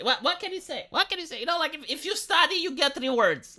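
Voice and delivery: high, soft, playful false modesty; mock modest. Streamer showing off history knowledge to viewers, mock modest. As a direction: shrug it off in a small high voice.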